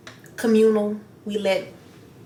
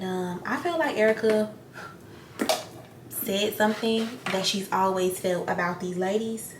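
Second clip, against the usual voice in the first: ordinary talk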